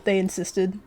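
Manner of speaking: ordinary talk, nothing marked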